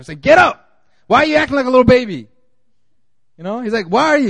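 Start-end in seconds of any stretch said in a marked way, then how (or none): 0.2-2.3 high, loud, fast scolding; mock rebuke. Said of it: "God's response is like a rebuke". 3.9-4.3 rebuking. Retold or imitated. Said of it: "he was rebuking Joshua".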